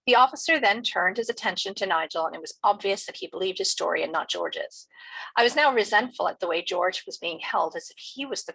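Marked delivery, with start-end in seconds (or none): none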